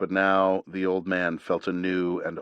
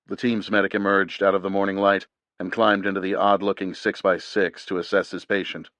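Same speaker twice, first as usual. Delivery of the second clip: ordinary delivery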